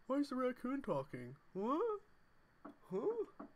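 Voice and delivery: put-on high, puzzled girlfriend voice; impression of girlfriend. Streamer mimics girlfriend asking about a talking raccoon, high and baffled. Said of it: "She'd be like".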